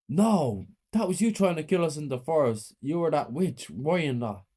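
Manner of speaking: high horrified accusation; horrified realisation. A narrator's reading. Child to the mother, horrified. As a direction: realise it with dread.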